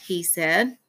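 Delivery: plain reading voice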